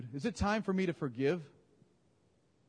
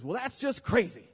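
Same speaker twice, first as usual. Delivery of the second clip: higher, louder, in his voice; imitating the man; 9 semitones higher, 4 dB louder than usual. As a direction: give it the man's own sound. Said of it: "so he made it sound better".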